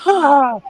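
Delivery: very high mock scream of pain; short high-pitched mock yelp. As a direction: squeal it like fur's being ripped. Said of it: "he's screaming".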